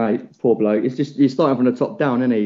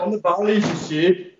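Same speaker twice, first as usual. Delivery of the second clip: a lightly put-on Indian accent, higher pitch; mock Indian accent; 7 semitones higher than usual. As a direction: tease in a bad Indian accent. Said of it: "What the fuck accent was that?".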